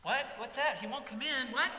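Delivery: high, loud, disbelieving questions; can't believe his ears; startled father. Acted.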